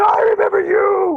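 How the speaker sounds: loud, slow, high-pitched shriek; outraged creature. Acted. Mock griffin recognises the traveler who left it, outraged screech. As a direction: screech it, drawing out every word.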